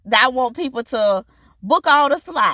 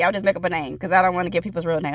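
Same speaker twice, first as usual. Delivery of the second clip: ordinary talk, nothing marked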